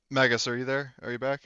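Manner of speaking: ordinary talk, nothing marked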